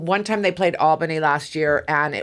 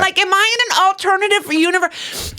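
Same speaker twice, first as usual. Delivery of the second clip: much higher, indignant outburst of disbelief; half-playful shocked outburst; mock disbelief; 11 semitones higher than usual. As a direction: act stunned and outraged. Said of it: "I was like".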